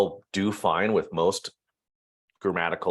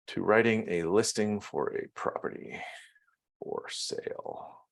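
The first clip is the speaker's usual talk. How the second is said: softer, fading to an unvoiced murmur; typing aloud; 8 dB quieter than usual. Real, not acted. Trainer types a listing prompt, murmuring along. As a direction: drag the words out as you type.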